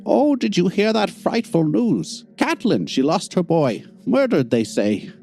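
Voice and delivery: put-on high, slow gossiping old woman; gossiping old lady. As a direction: share grim gossip in a hushed granny voice.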